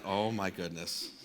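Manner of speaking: low, slow, soft dismay; mortified. Retold or imitated. Student realising what he shouted in chapel, quietly mortified. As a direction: let it sink in quietly, aghast. Mortified after his outburst.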